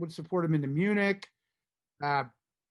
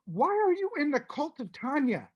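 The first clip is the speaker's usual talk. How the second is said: incredulous lecture; 9 semitones higher than usual. Retold or imitated. Lecturing John about the cult.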